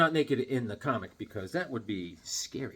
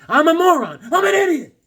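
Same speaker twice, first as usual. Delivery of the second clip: much higher, louder self-scolding; self-mocking wail; 12 semitones higher, 8 dB louder than usual. Real, not acted. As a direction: wail it at yourself.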